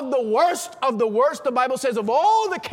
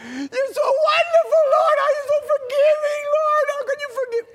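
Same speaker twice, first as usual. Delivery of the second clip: hammed-up blubbering prayer to the Lord; crying like a baby; 13 semitones higher than usual. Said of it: "And I started crying like a baby".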